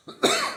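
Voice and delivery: sharp real cough; short sudden cough. Real, not acted. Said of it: "I've been coughing more lately".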